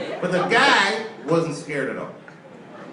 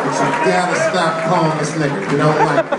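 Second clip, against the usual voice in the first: loud exasperated plea; 5 semitones higher, 6 dB louder than usual. Retold or imitated. Pleading with the attacker.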